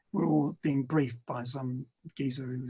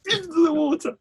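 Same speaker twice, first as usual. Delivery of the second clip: triumphant shout; 12 semitones higher, 7 dB louder, slower than usual. Retold or imitated. Triumphant at the pool.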